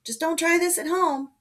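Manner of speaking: high, loud jaunty warning; called back smiling. A narrator's reading. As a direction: call it out with a smile. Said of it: "called back with a smile".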